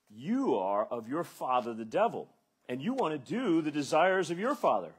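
loud, booming scripture reading; forceful rebuke. Acted. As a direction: thunder out the rebuke. Preacher reading Jesus's rebuke aloud.

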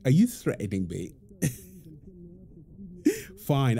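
ordinary talk, nothing marked

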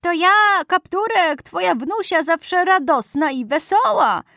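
high put-on little-girl voice; wolf in disguised voice. A narrator's reading. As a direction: call out as a wolf faking a cheerful granddaughter. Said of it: "zawołał wilk zmienionym głosem". (wilk udający wysokim głosem wnuczkę)

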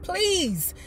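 high drawn-out mock plea; a child begging her father, lightly played. Child pleading with her dad for skates, mock pleading. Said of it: "I begged".

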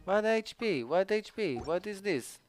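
high, falling incredulous questions; dismayed disbelief. Real, not acted. Gamer seeing HP instead of crit rate, dismayed disbelief. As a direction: protest in a high voice.

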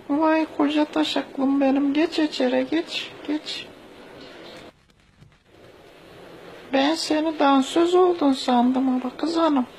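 high falsetto mother voice; turkish cartoon dub. Falsetto mother welcomes son in.

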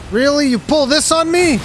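high, loud, indignant yelling; furious outrage. Real, not acted. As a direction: shout it like you've been betrayed. Raging at game boss.

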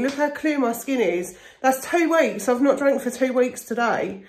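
usual speaking voice

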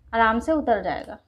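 usual speaking voice

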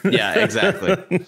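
laughing while agreeing. Real, not acted. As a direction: say it through a laugh. Laughing along at the story.

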